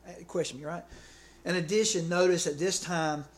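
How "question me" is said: ordinary talk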